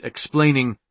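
ordinary delivery, nothing marked

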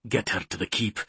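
softly murmured thought; inner resolve. A narrator's reading. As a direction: plan it quietly to yourself. Hero's thought, planning to himself.

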